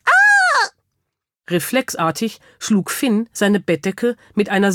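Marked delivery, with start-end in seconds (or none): 0.0-0.7 very high, loud startled shriek; creature's scream. A narrator's reading. Startled creature screams back at Finn, high shriek. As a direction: squeal in shock like a small animal. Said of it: "schrie ebenfalls".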